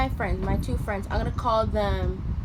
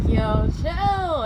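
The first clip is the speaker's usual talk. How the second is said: mock chill; 4 semitones higher, 7 dB louder than usual. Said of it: "And I'm like".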